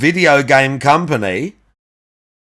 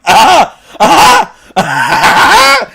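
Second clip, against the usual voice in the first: loud laughter; 14 semitones higher, 8 dB louder than usual. Real, not acted. Cracking up at the clip.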